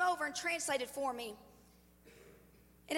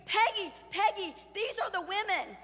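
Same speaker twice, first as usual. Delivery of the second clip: calling out; 4 semitones higher, 3 dB louder than usual. Retold or imitated. Translator calling from a distance.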